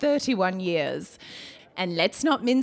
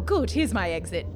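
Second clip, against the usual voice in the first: ordinary delivery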